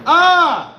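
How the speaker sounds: a sudden very high, loud shriek; wild scream. Shown as a demonstration. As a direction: let out a piercing scream on cue. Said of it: "All right, let's try this".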